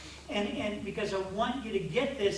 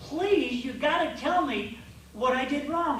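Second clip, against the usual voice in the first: raised, louder, exasperated pleading; frustrated plea; 6 semitones higher, 5 dB louder, slower than usual. Retold or imitated. Husband pleading with silent wife, frustrated and raised. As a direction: beg her to tell you, fed up. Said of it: "I would just be frustrated".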